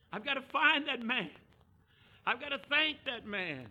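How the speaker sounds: high, loud, driven exclamation; urgent determination. Retold or imitated. Samaritan racing back to thank Jesus, urgent. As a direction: call it out with urgency.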